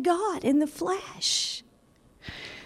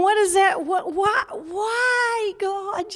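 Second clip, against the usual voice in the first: higher, rising exasperated plea; mock exasperated cry; 4 semitones higher, slower than usual. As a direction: act out the baffled listener, higher. Acting out listener's question.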